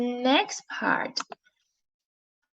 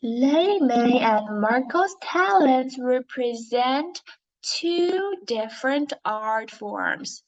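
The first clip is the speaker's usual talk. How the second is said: normal talking voice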